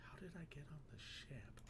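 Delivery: whispered line. Acted. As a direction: murmur it like a lost thought. Player's line read as aside.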